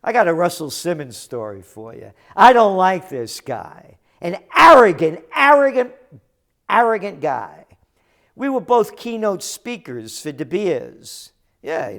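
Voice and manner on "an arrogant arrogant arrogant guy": louder, higher, spitting the word arrogant; contemptuous repeated arrogant; angry emphasis. Real, not acted. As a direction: hammer arrogant with disdain. Venting about Russell Simmons.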